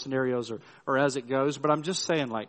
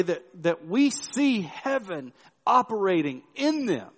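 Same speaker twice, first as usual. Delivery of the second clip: raised, louder preaching push, higher in pitch; preaching at full force; 5 semitones higher, 4 dB louder than usual. Acted. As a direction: drive the point home like a preacher building to a peak. Preaching builds in intensity.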